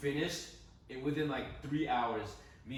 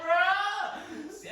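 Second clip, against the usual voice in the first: laughing; 9 dB louder than usual. Retold or imitated. Reacting in disbelief.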